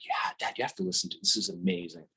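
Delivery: soft imitation of an eager daughter; hushed urging. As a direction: coax Dad to listen. Daughter urging him to listen.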